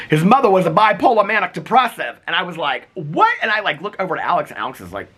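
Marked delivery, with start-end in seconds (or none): 0.1-2.2 high, loud East Coast grandma voice; real loud grandma. Retold or imitated. Friend's grandma remarking at the pool, talking real loud. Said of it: "she talks real loud". 2.9-3.3 mock-startled high-pitched question. Poolside reaction to grandma's remark, mock startled.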